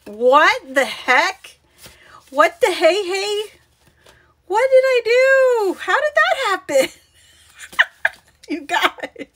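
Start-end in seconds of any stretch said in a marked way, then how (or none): 0.1-6.9 exclaimed dismay. Real, not acted. Crafter discovering her own mistake, exclaimed dismay. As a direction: wail in comic bewilderment at the mistake. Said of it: "it's gonna be like".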